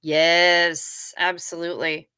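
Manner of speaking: usual speaking voice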